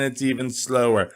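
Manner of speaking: usual speaking voice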